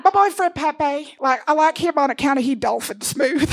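put-on small Missouri girl, sweetly entitled; Bitsy voice. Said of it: "And she's like".

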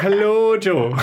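put-on reply with a half-laughed Irish accent; his own Irish reply. [name] mimicking his own Irish accent back to Jo. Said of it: "And I'll be like".

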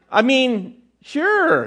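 high, laughing flustered agreement; flustered yes. Retold or imitated. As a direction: stammer a nervous laughing sure. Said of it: "And he goes".

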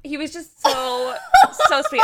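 high, laughing gush over him. Real, not acted. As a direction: swoon through laughter. Gushing about Broadway star.

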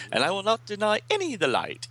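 high, quick heroine voice. Acted. Misa promising her light to all, high and quick. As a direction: lift the pitch high and speed up. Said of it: "And Misa says".

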